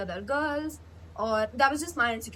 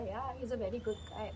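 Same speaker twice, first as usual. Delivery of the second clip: much softer, offhand repeat of he's a very good guy; mimicking the other girl's offhand, muttered reply; mock quiet echo; 9 dB quieter than usual. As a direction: let the repeat fall away half-dismissively. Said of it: "And P is like".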